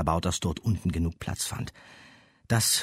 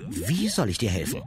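put-on higher young girl voice; 6 semitones higher than usual. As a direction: ask as a girl. Girl asks.

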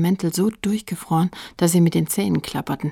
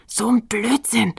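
slow, higher hissed whisper; hissing; 4 semitones higher, 3 dB louder than usual. A narrator's reading. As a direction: hiss it dismissively at your friend. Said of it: "zischte Fred". (gezischt, gedehnt)

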